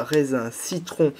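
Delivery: ordinary talk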